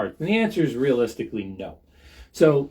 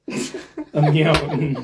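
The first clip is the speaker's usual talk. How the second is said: slow, laughing through the punchline; 4 semitones higher, 4 dB louder, much slower than usual. Acted. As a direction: drag out the pun while cracking up.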